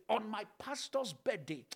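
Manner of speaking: ordinary talk, nothing marked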